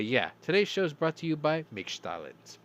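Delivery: ordinary talk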